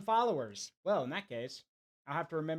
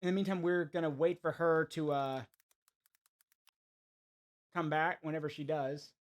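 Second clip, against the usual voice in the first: talking while chewing gum; 2 semitones lower than usual. Real, not acted. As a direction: chew gum as you talk. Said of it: "I'm chewing gum right now".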